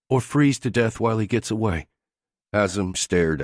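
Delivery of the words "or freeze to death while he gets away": female character voice. A narrator's reading. Jamie goads Haslam, female voice.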